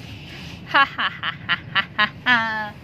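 low, soft villain cackle; evil cackle. Acted. Cat laughs over her trapped prey, low evil cackle.

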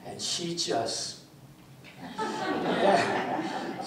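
ordinary delivery

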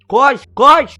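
high, loud yell to run; shouted urging. Real, not acted. Yelling at his friend to run through the snow.

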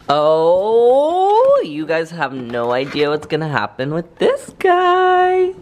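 thrilled, loud and high; big excited build-up, slow. Real, not acted. Excited about the air freshener.

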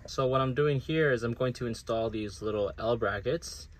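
ordinary talk, nothing marked

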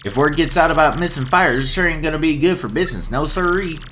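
Southern twang for a cheerful ringmaster; southern accent. Acted. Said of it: "I love this accent I'm giving him".

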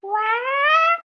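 high, loud, drawn-out what; shocked what. Pretend. Hero hears the villain has arrived, shocked high yelp.

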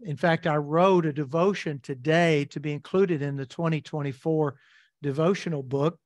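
ordinary talk, nothing marked

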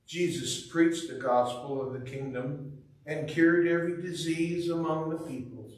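ordinary delivery

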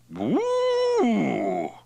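loud, high drawn-out "woo"; startled whoop. Acted. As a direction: whoop at the burn of strong liquor. Reaction to swallowing strong whisky.

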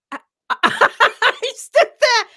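high laughter breaking into the words; helpless laugh through the sentence. Real, not acted. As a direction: crack up while telling it. Laughs telling her own embarrassment.

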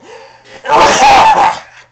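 harsh coughing fit; loud cough. Real, not acted. Clearing a cold with a loud cough. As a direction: cough it out hard, away from the mic. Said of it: "muss kurz husten".

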